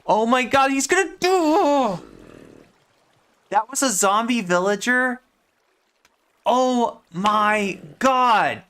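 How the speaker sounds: high, loud and stretched out; excited shriek. Real, not acted. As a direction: freak out at the surprise. Zombie villager surprise.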